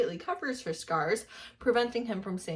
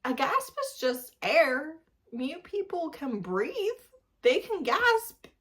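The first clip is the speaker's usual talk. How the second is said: sarcastic explaining; 5 semitones higher, slower than usual. Real, not acted. Sarcastically explaining basic biology.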